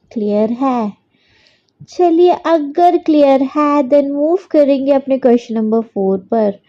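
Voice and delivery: ordinary delivery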